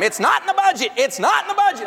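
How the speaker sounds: high mock yell of a frazzled mom. Mother yelling at her screaming toddler, hammed-up. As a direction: snap it at the kid like a fed-up mother. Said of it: "she's yelling at him".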